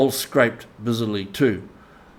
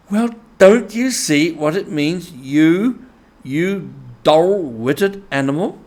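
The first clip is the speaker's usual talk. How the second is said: higher, louder, exasperated scolding; impatient outburst; 6 semitones higher, 5 dB louder than usual. A narrator's reading. Rat scolding the slow mole, impatient and loud. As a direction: drag out the insult. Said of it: "cried the rat impatiently".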